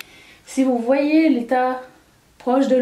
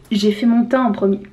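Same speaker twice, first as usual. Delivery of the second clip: ordinary talk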